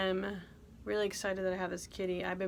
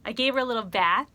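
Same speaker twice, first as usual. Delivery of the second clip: usual speaking voice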